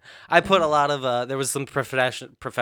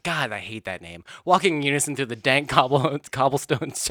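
normal talking voice

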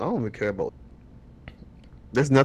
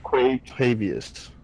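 slow drawn-out name; much slower than usual. Pretend. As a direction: stretch every syllable slowly. Drawing out the name.

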